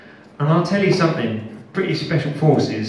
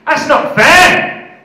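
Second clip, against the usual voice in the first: much higher, louder outraged call; indignant shout; 14 semitones higher, 12 dB louder than usual. Retold or imitated. Bound prisoner shouting after the interrogator, indignant. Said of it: "And I said".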